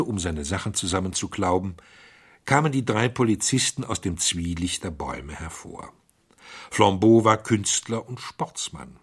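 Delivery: usual speaking voice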